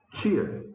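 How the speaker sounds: "tia" opening on a ch sound; pronúncia paulista. Shown as a demonstration. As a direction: soften the t of "tia" into ch. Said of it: "alguma coisa do tipo". ("tia" com som de tch)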